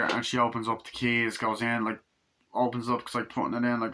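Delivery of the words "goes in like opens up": normal talking voice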